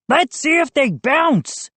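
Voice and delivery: high-pitched gleeful call; excited high gnome voice. A narrator's reading. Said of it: "a gnome called from near the back".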